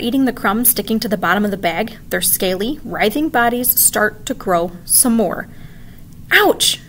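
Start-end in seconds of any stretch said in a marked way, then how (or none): 6.3-6.8 high loud yelp of pain; sudden ouch. A narrator's reading. Vic burned by the melting bag. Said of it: "Vic cries".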